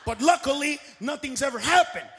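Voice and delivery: ordinary talk, nothing marked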